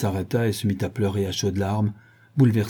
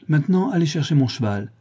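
higher-pitched, matter-of-fact command; ordering; 6 semitones higher than usual. A narrator's reading. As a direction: send someone off on an errand. Première demande, répétée ensuite. (ton de commandement)

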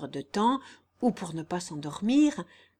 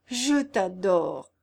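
slow, emphatic declaration, louder; passionate confession; 5 dB louder, much slower than usual. Acted. Guillaume unable to restrain himself longer, passionate confession. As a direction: let the confession out, word by word.

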